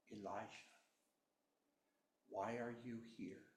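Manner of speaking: soft, hushed voice of God; quiet voice. A narrator's reading. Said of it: "this quiet voice says".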